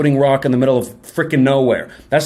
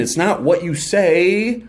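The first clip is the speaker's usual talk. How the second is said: slow emphasis; slower than usual. Shown as a demonstration. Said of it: "it's how you say it".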